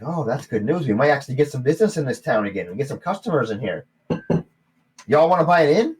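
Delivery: high, loud eager salesman gnome; gnome barkeep. Acted. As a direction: push the sale hopefully.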